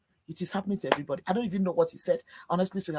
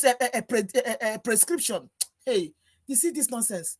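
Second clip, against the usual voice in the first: normal talking voice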